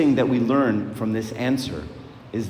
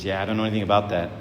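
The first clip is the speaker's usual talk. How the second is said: offhand shrug; 3 semitones lower, much faster than usual. Retold or imitated. Thief answers angels, retold.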